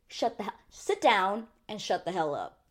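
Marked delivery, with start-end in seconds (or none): none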